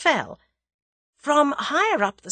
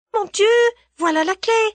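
much higher, louder French exclamation; feigned surprise; 13 semitones higher, 6 dB louder than usual. Acted. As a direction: pretend to find the key. Feigned surprise finding key.

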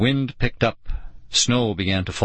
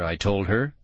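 ordinary delivery, nothing marked

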